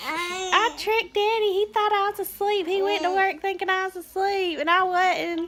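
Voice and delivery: put-on proud little boy bragging; imitating a giggly child; child's voice. Quoting her giggling oldest son.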